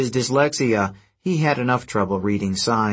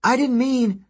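high-pitched protest called after someone; distressed apology; 13 semitones higher than usual. A narrator's reading. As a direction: plead urgently in a high voice. Hazel calls after fleeing harpy.

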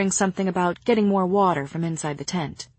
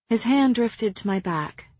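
usual speaking voice

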